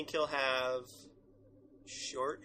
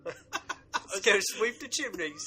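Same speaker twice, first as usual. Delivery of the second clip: higher, laughing put-on Cockney, slowed right down; mock Cockney accent; 6 semitones higher, 4 dB louder, slower than usual. Teasing Clara as a Cockney Doctor, hammed-up Cockney accent. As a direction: ham up a chimney-sweep Cockney accent. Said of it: "His Cockney accent".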